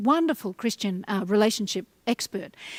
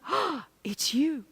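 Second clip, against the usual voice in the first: softer, slow, glowing "Oh, it's you"; softly delighted; 4 semitones higher, 6 dB quieter, much slower than usual. Shown as a demonstration. New lover at the door on the first Friday date, soft delighted greeting. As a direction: greet them like the best surprise. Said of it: "you go".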